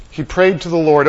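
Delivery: usual speaking voice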